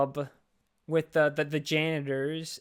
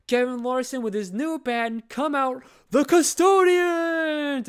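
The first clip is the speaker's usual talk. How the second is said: building to a much higher, louder drawn-out announcement; announcer hype; 9 semitones higher, 5 dB louder than usual. Retold or imitated. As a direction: introduce the band like a club emcee, stretching the last name. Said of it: "he's like".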